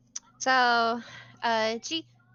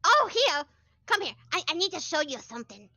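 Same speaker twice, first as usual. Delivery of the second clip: higher, excited bookish woman; eager NPC voice; 6 semitones higher than usual. Acted. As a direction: show off the crystals eagerly.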